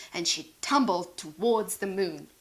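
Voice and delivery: ordinary delivery, nothing marked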